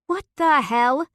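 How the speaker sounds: high, loud, exasperated demand; angry outburst. A narrator's reading. Wife furious at husband after the guest flees. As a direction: throw the question at him.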